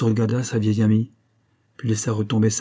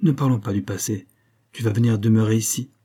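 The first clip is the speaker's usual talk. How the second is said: usual speaking voice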